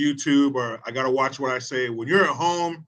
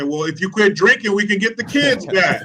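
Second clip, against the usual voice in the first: loud teasing jab; 7 semitones higher, 7 dB louder than usual. Retold or imitated. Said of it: "and I say".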